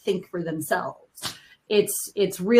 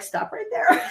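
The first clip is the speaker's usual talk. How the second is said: much higher, laughing as the line lands; playful retold halt, pitched way up; mock interruption tipping into laughter; laughing mock objection; 13 semitones higher than usual. Retelling her comeback to advising coaches.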